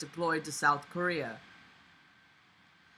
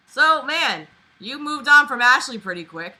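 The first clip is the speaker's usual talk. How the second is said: teasing; 7 semitones higher, 10 dB louder than usual. Real, not acted. Teasing the character.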